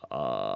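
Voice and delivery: long, smooth yawning "ah" gliding up from very low; big yawn slide. Shown as a demonstration. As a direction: glide through a big open yawn. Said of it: "Let's try one more time".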